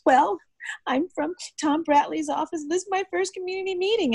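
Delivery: very high, helpless imitation; mock flustered official. Rookie official explains himself before collapsing, high flustered imitation.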